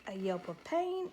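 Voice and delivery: ordinary delivery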